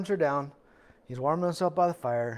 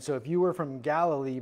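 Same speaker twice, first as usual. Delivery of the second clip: normal talking voice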